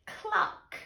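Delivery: high, short cluck; hen's cluck. A narrator's reading. Hen after the thieves are caught, high cluck. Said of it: "the hen said".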